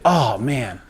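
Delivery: amused "oh man" after a chuckle; imitated laugh. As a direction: retell it with a knowing little laugh. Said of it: "And he laughed".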